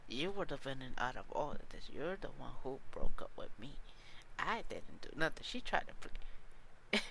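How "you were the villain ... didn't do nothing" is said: mock her defiant, sassy retort; sassy ex-girlfriend. Podcaster mimics ex-girlfriend rejecting the villain role, sassy. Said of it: "she actually talked like that".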